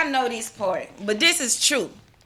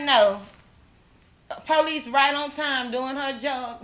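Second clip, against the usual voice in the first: usual speaking voice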